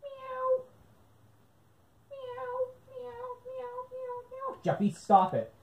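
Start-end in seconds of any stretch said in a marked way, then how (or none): 0.0-4.6 very high, soft meowing; squeaky soft kitten meows. Pretend. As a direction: mew like a small cat to annoy. Kid character pretending to be cat.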